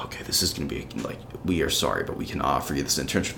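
low, hushed read of a dreaded rejection; subdued, resigned. Retold or imitated. Student imagines the rejection email he dreads, low and subdued. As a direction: drop the voice low and soft, expecting bad news.

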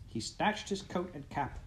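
ordinary delivery

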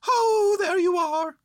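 very high, loud call. A narrator's reading. Bear greeting boy below, high loud call. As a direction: hail the boy loudly from up high. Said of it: "called down Winnie the Pooh".

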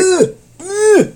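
high, loud ughs of revulsion; repeated high-pitched retching ughs; disgusted squeal. Real, not acted. Disgusted at the food pictures.

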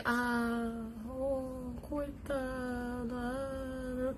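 soft, drawn-out mock pity; hammed-up "poor thing" sighs. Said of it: "Todo mundo fica em volta de você". (pena fingida, bem baixinha e arrastada)

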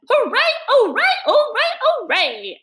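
very high, loud repeated cheers; cheering. A narrator's reading. Rat jigging in the snow, high whooping cheers. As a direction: whoop it up while dancing a jig.

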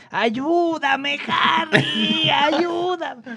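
put-on high, pleading older man calling for help; imitating older coworker. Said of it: "tengo que imitar su voz". (voz aguda y suplicante de señor mayor)

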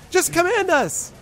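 high, slow goblin cry; Gleek. Acted. Goblin begs the boss for a command, high drawn-out call. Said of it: "like, yelling at the bush".

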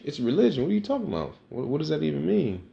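high, incredulous mock voice; brother's incredulous retort. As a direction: play the brother, baffled and a bit offended. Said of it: "And he was like".

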